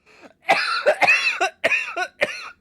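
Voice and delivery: loud, high cackling fit; real helpless laughter. Real, not acted. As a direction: burst into long, high, uncontrolled laughter. Helpless laughter at what happened.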